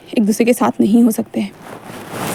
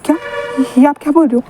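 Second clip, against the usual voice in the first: ordinary delivery, nothing marked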